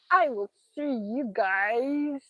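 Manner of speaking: slow, stretched froggy voice; Kermit the Frog. Real, not acted. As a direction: draw the sign-off out like a puppet frog. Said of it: "I sound like Kermit the Frog".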